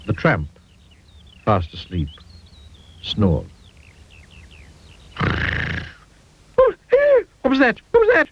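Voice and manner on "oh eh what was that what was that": frightened shriek. A narrator's reading. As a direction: shriek at the unseen noise. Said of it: "shrieked Mister Jelly".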